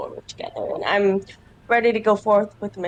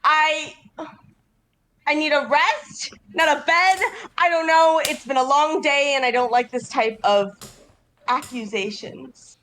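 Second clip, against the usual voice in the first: higher-pitched, indignant character voice; upset, weary adventurer; 6 semitones higher than usual. Acted. Adventurer rebuffs accusations before slamming the door, upset.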